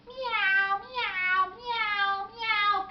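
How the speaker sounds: high, loud, drawn-out meows; wailing cat imitation; cat meowing. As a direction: meow like a noisy cat. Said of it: "Cats making cat sounds".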